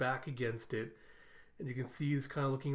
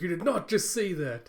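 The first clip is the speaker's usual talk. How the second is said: louder, higher mock disbelief; shocked; 5 semitones higher, 6 dB louder than usual. [name] to the coworker who volunteered her, mock shock. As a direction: imitate someone appalled at being volunteered. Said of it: "[name]'s just shocked".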